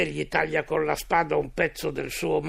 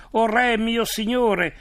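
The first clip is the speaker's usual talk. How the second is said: raised, higher reading voice, hailing a king; restrained calling out; 6 semitones higher, 4 dB louder than usual. A narrator's reading. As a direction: call out to the king without a full shout. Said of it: "gridò a Saul". (richiamo a voce alta, misurato)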